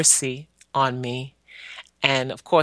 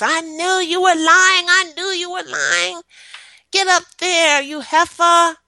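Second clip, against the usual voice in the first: high, louder imitation of a scolding aunt; imitating angry aunt; 6 dB louder than usual. Aunt scolding the child she just beat, high mock fury. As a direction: spit it out like the furious aunt mid-beating. Aunt's voice while beating child.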